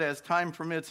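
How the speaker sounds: ordinary delivery, nothing marked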